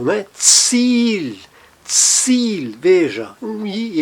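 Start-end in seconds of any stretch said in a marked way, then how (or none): 0.4-2.7 Ziel as a stretched "tseel"; long I. Shown as a demonstration. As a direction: hold the "ee" in Ziel. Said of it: "sempre o I longo".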